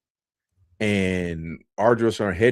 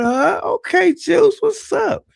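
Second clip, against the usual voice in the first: high, loud, slow mocking sergeant voice; mock drill sergeant; 6 dB louder, slower than usual. Drill sergeant teasing a recruit, high and loud. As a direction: draw out the nickname with a teasing grin. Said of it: "He said".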